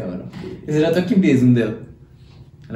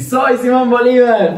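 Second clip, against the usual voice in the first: higher, louder, slow grand proclamation; mock drunk announcing himself; drunk boast; 10 semitones higher, 8 dB louder, slower than usual. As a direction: declare it like a drunk hero. Drunk friend's boast, retold.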